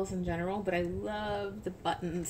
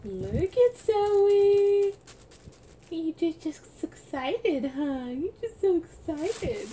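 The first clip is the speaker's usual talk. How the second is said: put-on high, loud, slow baby-talk coo; 10 semitones higher, 9 dB louder, slower than usual. Mom cooing at her doll Zoe, high and loud.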